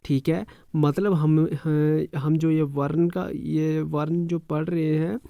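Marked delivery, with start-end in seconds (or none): none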